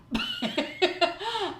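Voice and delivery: bright burst of laughter, falling away; sudden giggle, high and loud. Real, not acted. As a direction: laugh at herself for forgetting. Laughs at forgetting date.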